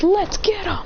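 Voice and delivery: high-pitched rallying call; eager battle cry. Acted. Acted battle cry in story.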